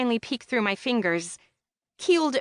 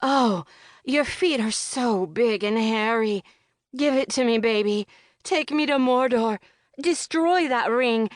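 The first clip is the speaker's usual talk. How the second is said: mock sex moaning; 3 semitones higher than usual. Friend teasing about the hobbit date, hammed-up lover's moans. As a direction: ham up a breathy lover begging. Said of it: "she goes full tilt".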